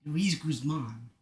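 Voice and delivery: attempted Mexican accent; lightly put-on Mexican accent, feeling for the voice; Luis Guzman. Shown as a demonstration. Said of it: "I need to channel Luis Guzman".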